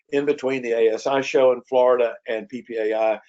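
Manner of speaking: normal talking voice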